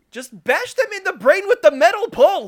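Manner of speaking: yelled high and loud; shouting. Retold or imitated. Mocking Donatello's fighting.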